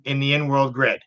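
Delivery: ordinary talk, nothing marked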